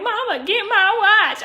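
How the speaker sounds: high, drawn-out mock plea; calling to mama. Arrestee asking mama to save a Rolex, slow high plea. Retold call to mother in handcuffs.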